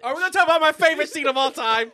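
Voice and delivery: high, fast eager question; mock excitement. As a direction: rush it out excitedly. Excited lead-in to favorite scene.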